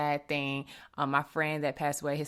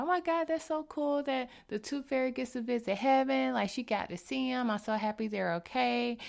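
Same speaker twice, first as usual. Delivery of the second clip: put-on excited child voice; 10 semitones higher than usual. Mother voices her excited son.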